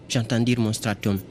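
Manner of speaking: normal talking voice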